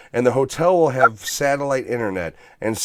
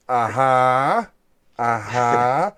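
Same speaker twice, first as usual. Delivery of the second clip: bored cop; 4 dB louder, much slower than usual. Retold or imitated. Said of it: "the cop's basically just like".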